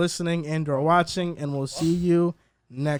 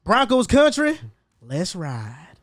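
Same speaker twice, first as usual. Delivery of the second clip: country voice; much slower than usual. Shown as a demonstration. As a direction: hype it like a cowboy announcer. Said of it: "I'm gonna do a country voice though".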